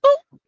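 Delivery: high, loud playful boop sound; a silly high boop to end the point; comic boop. Real, not acted. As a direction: pop it out like a cartoon sound effect. Punchline sound effect.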